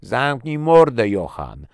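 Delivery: slow, very loud furious yell. A narrator's reading. Soldier turns on his commander, yelling in fury. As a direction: scream at him to silence him. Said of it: "wydarł się Monroe".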